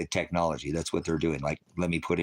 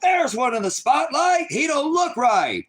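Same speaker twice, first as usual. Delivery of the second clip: much higher, loud, slow shouted Trump rant; Trump at his rallies; 6 dB louder, slower than usual. Retold or imitated. Streamer mocking Trump at his rallies, high slow shouted impression. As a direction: bellow it like a rally crowd-baiter. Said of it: "This is Trump at his rallies".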